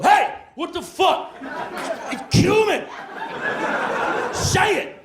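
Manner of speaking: very high, stretched-out mock outburst; furious roommate. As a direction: demand it in a high, exasperated cry. Acted roommate bursting out, furious.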